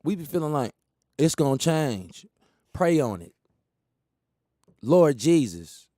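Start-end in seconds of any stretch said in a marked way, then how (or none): 4.8-5.9 mock prayer.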